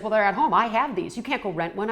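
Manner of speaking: heated retort in an argument; angry partner. Retold or imitated. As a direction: lay down the law, irritated. Retold quarrel, angry partner.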